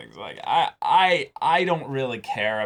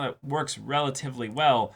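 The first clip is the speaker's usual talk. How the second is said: ordinary talk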